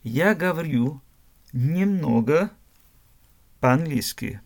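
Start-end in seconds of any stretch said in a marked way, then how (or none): none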